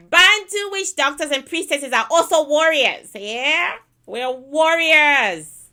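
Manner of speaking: loud and emphatic, warriors stretched slow; loud proud declaration. Real, not acted. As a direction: proclaim your strength to the spirit.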